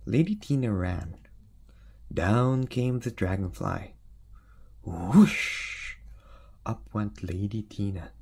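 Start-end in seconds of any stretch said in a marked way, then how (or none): 4.8-6.0 whoosh. Acted. Sound effect of dragonfly.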